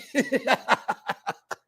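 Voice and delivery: high-pitched run of laughter; helpless giggling fit; hearty laugh. Real, not acted. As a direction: burst out laughing at the absurdity. Cracking up over the absurd story.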